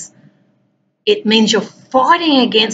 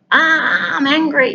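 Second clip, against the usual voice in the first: high, far-off pretend yell of anger; mock yell; 8 semitones higher, slower than usual. Shown as a demonstration. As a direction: let out a playful mock yell. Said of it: "where we can go and have a yell".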